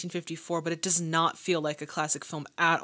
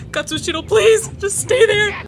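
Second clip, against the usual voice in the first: pleading call; 13 semitones higher, 10 dB louder than usual. Real, not acted. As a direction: beg him to stay put, calling out. Calling out to film character.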